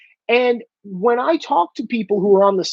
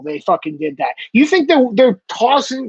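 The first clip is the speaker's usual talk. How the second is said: usual speaking voice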